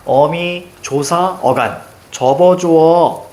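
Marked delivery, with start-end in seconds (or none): 2.1-3.3 drawn-out mnemonic. Shown as a demonstration. Teacher to students, chanting the mnemonic slowly. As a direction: stretch each syllable very slowly. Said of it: "됐습니까?".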